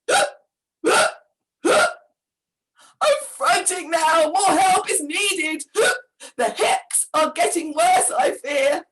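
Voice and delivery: frantic. A narrator's reading. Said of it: "in total desperation".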